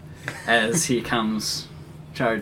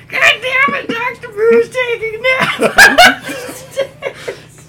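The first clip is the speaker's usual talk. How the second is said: put-on very high old woman voice, breaking into laughter; laughing old lady voice; 11 dB louder than usual. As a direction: try to hold the granny voice while laughing. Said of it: "drop that voice".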